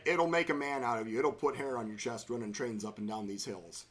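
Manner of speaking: ordinary talk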